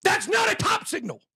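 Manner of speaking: high, insistent call-out; high-pitched rant; emphatic shout. Real, not acted. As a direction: fling it at the doubters. Ranting at critics.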